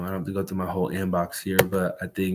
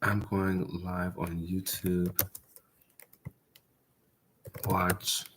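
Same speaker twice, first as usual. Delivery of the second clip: quiet, slow, typing it out; muttering while typing; 3 semitones lower, 7 dB quieter, slower than usual. Real, not acted. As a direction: mumble the message to yourself. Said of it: "So I am messaging a couple people".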